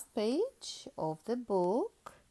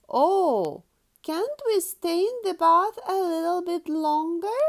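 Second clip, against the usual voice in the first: pleading Peppa; 8 semitones higher, 6 dB louder than usual. Acted.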